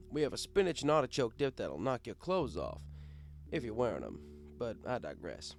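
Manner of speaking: usual speaking voice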